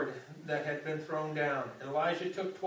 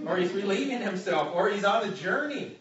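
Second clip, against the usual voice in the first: mocking; 4 semitones higher, 6 dB louder than usual. A narrator's reading. Preacher hams up Elijah's taunt.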